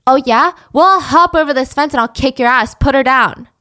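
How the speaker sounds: loud, challenging threat; angry threat. Retold or imitated. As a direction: threaten to come over and fight. Neighbor threatens Tony.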